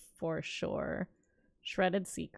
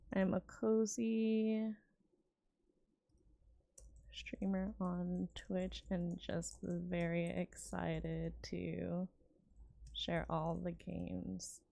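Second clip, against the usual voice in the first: soft cozy intro; 10 dB quieter, slower than usual. Real, not acted. Self-introduction as cozy streamer.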